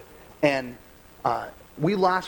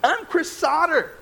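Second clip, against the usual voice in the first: higher, self-important mock indignation, slowing on his own name; mock entitled rant; 8 semitones higher than usual. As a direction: play up your own spoiled outrage, hammed up. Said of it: "I'm like".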